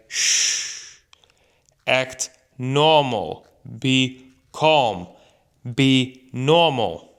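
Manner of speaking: slow, spaced-out hushing commands; mildly stern, drawn-out telling-off; hushing reprimand. Retold or imitated. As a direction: imitate a parent shushing a happy child. Imitates an adult hushing happy child.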